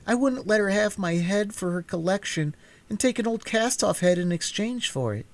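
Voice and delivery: put-on high, indignant little-girl voice. A narrator's reading. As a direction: protest that she kept her head. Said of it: "exclaimed Dorothy".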